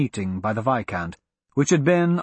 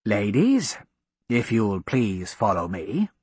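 deeper slow gentleman; lower male voice; 5 semitones lower, slower than usual. Acted. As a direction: voice the viscount, low and slow. Viscount voiced by narrator.

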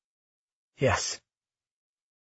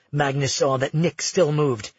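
usual speaking voice

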